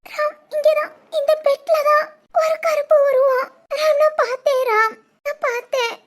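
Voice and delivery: high, tearful panic; frightened crying. Acted. Wife tells husband of a dark figure on the bed, crying in fear. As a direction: blurt it out through terrified sobs. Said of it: "சரி, அழாத".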